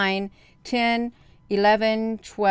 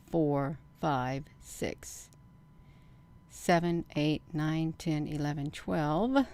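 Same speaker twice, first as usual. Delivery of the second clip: quiet counting; 2 semitones lower, 9 dB quieter than usual. Real, not acted. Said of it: "I'm getting myself too excited".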